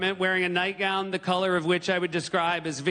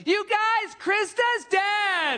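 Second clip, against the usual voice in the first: mock yell out window; much slower than usual. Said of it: "open up the window and be like".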